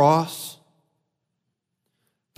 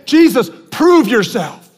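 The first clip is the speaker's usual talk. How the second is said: raised, higher-pitched demand; shouting crowd; 5 semitones higher, 4 dB louder, slower than usual. Retold or imitated. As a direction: call out insistently as the taunting crowd. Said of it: "then they shouted".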